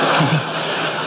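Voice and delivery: a short breathy real chuckle; soft chuckle. Real, not acted. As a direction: laugh quietly at the memory. Laughs at his own story.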